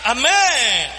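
full-force pulpit preaching; fiery preaching. Acted. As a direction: preach it out to the congregation.

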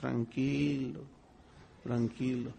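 slow reassuring calm; calm soothing. Retold or imitated. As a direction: hush him down gently. God calming Paul.

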